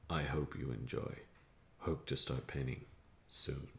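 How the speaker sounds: hushed nature documentary; low, soft documentary narration; narrator voice. Shown as a demonstration. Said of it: "you should do this one in the narrator voice".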